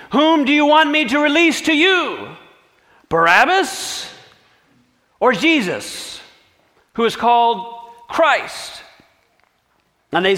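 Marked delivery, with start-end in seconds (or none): 0.1-8.8 Pilate addressing crowd. Acted. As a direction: call out the choice to the mob. Pilate offers the crowd a choice.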